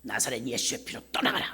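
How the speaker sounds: low, soft stern command to a spirit; hushed exorcism rebuke; inner rebuke. Retold or imitated. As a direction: rebuke the demon under your breath. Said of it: "속으로".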